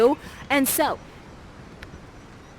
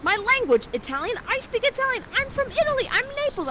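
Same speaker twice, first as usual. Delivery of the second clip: much higher and louder, hammed-up gush; excited Ferdinand; 14 semitones higher, 3 dB louder, faster than usual. Ferdinand excited by his language, much higher and louder. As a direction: blurt it out like an overeager boy. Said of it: "Ferdinand is like".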